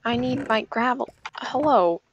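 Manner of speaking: ordinary talk, nothing marked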